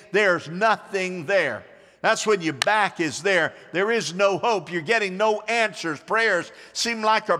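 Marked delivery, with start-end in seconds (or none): 0.1-1.6 slow emphatic line. Shown as a demonstration. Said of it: "Say that with me".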